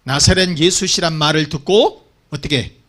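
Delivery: ordinary talk, nothing marked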